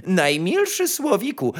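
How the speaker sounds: high, honeyed courtly address; ceremonious chamberlain. A narrator's reading. Chamberlain addressing the nightingale, ceremonious and high. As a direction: address the bird grandly, high. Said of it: "przemówił szambelan".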